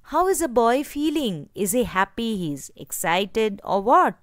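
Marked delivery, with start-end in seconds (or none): none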